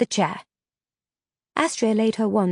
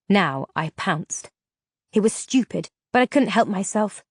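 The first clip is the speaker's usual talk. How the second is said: plain reading voice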